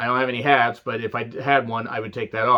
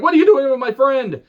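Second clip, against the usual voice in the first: angry yell; 10 semitones higher, 6 dB louder than usual. Retold or imitated. L A Knight confronting Grimes.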